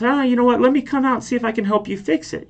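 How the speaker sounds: bright, high, eager offer; high-pitched retold voice. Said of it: "and this guy goes".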